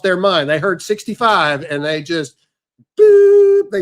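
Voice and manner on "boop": high sharp "boop" beep noise; mock beep sound. Mimicking a censor beep at old folks.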